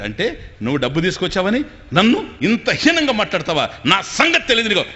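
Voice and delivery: loud, high threatening yell; mock angry shout. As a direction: shout it so the guest hears. Staged fight, husband threatening.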